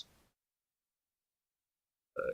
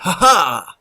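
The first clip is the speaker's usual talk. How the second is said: triumphant laugh; 8 semitones higher, 9 dB louder than usual. Real, not acted. Victory after killing keeper.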